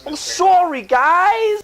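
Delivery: very high, squeaky mock apology; silly character voice. Performing the character on request, silly high voice. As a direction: play the goofy character way up high. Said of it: "play on, play on that character".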